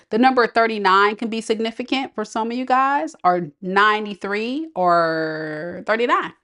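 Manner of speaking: ordinary delivery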